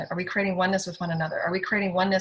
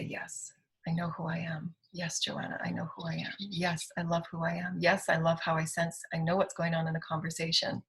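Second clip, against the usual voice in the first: soft, hushed affirmations; quiet self-affirmation; 6 dB quieter than usual. Acted. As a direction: say it softly to yourself.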